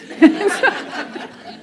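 breathy high giggle after the punchline; high laugh. Real, not acted. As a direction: let a laugh escape at the joke.

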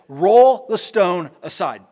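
loud, slow, commanding order; firm command. Acted. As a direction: call it out with authority, word by word. Jesus commands at the tomb.

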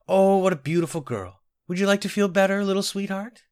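put-on high, sweet talk to a child; cooing. A narrator's reading. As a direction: coo over the little girl. Netta coos to the little girl.

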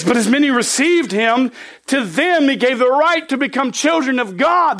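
scripture reading. Acted. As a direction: proclaim the verse to the congregation. Reads scripture aloud.

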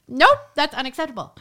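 loud, high, laughing refusal; comically emphatic veto; retold flat refusal. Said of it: "I was like".

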